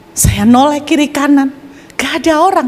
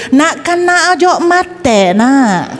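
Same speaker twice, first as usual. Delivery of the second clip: put-on higher, slow Madurese accent; 5 semitones higher, much slower than usual. Preacher repeats the Madurese neighbour, higher Madurese accent. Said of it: "Kalau Madura kan gitu".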